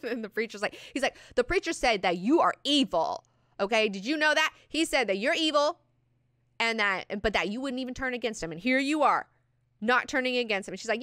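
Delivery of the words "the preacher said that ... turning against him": high, loud, insistent accusation; hammed-up needling, pushing for a reaction; acted goading. Acts out Javid pressing accusations.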